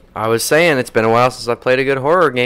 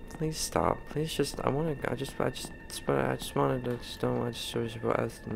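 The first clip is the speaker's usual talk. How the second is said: flustered soft mumbling; 13 dB quieter than usual. Real, not acted. Stammering pleas at ghost.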